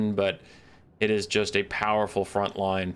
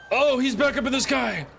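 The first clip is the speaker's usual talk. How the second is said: excited high exclamation; 4 dB louder than usual. Real, not acted. Excited at the unit returning.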